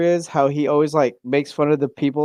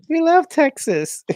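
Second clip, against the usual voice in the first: much higher, drawn-out gushing voice; imitating gushing Londoners; 13 semitones higher, slower than usual. Londoners greeting a Texan visitor, imitated high gushing delight. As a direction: mimic adoring fans, slow and high-pitched. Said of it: "when I went to London".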